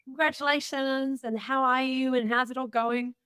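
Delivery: imitating a cheerful friend calling; cheery friend. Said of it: "rang me and said".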